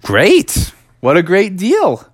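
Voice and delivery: bright high exclamation; acted upbeat enthusiasm; delighted. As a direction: jump on the deal eagerly, pitch up. Froggy delighted at the deal.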